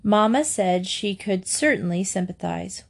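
usual speaking voice

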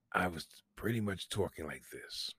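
hushed, careful sick-throat voice; imitating a sore throat. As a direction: talk softly as if the throat hurts. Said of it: "talking like this, trying not to clear my throat".

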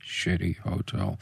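slow, low and softly voiced; low, quiet repeat. Real, not acted. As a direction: say it slowly, low and soft. Repeats the whispered words voiced.

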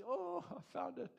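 high, delighted exclamation; joyful cry. Retold or imitated. Woman in the parable finding her coin, high and delighted. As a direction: squeal with joy at finding it.

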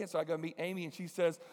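usual speaking voice

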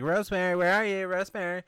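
high mock-girl searching call. Girl searching washroom for Rosemary, mock calling out.

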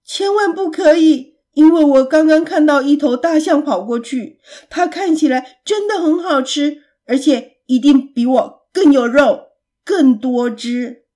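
loud, emphatic sales pitch; urgent persuading. A narrator's reading. Pig tempts monster with a juicier elephant, urgent persuading. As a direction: talk fast-thinking and loud to save yourself.

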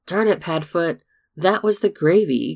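loud exasperated yell from another room; angry shout. Acted. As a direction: shout it out in frustration. James yells from the kitchen.

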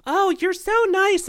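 put-on high, slow, cooing old-lady voice; sweet old lady. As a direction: coo it like a gushing old lady. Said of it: "it's the old ladies that will start shit".

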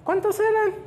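very high, questioning voice; puzzled. Acted. Jesus asking Juan about the other lepers, puzzled. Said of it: "Jesús se queda así como que".

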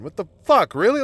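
usual speaking voice

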